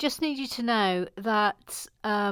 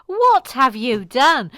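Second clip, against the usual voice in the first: loud, slow, drawn-out dismay; mock-horrified reproach; 2 semitones higher, 9 dB louder, slower than usual. Host blaming Mike, slow loud mock horror. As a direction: hammer each word out loudly. Louder, slower repeat.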